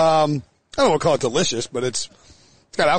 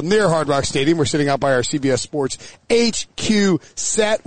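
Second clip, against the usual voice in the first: usual speaking voice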